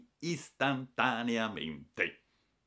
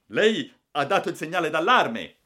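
high, forceful official challenge; raised-voice accusation; loud accusation; 9 semitones higher, 11 dB louder than usual. A narrator's reading. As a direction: confront the passenger loudly. Railway staff accuse the waking passenger.

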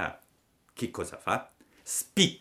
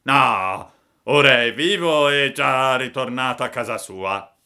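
put-on loud, raised old-man voice; little old man; 6 semitones higher, 9 dB louder than usual. A narrator's reading. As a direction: reassure loudly as an elderly villager. (vecchietto a voce forte)

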